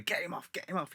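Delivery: high, hushed urging; retold soft, high-pitched plea; urgent aside. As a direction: press someone urgently without being overheard. Acts owner urging promoter to act.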